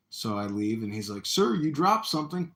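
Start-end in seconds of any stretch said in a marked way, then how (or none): 1.2-2.5 calling out politely. Retold or imitated. Acts out attacker's decoy call before punch.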